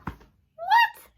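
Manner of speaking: shrill high disbelieving shriek; high outraged what. Real, not acted. Outrage at being kept.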